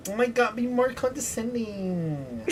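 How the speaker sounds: high, soft, gushing impression; Jonathan Van Ness. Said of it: "you reminded me of Jonathan Van Ness".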